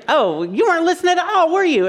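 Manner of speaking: high-pitched, fast mock accusation; hammed-up indignant wife; accusing spouse. Acted spouse catching him out.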